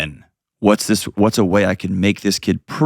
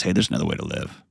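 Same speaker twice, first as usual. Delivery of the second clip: gentle exhale; 2 semitones lower, 7 dB quieter, faster than usual. Retold or imitated. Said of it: "exhales and says".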